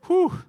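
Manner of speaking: a sharp, high whistle-like whew; loud high whew. Real, not acted. Preacher winces at Peter's public rebuke, loud high whew. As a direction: blow it out like you're wincing at a blow.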